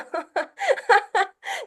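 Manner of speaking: rising laugh; high laugh. Real, not acted. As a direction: let a laugh climb up. Laughs at own joke.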